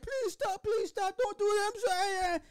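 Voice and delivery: very high-pitched wailing plea; mock frantic begging. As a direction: beg shrilly, imitating a victim in pain. Acting out a victim begging.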